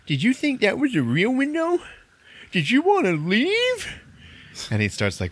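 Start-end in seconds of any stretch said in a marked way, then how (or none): 0.1-3.9 high-pitched mock outburst of a kid; freaking out. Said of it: "freaking out on her".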